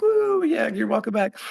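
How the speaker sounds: high whooping welcome; imitating a crowd cheering at the homecoming; mock cheer. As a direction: cheer them home with a whoop.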